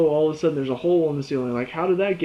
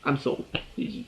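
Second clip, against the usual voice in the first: normal talking voice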